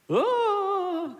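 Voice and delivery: high startled oh; a sudden high gasp of alarm; parent's gasp. Retold or imitated. Parent watching baby wobble, high startled oh.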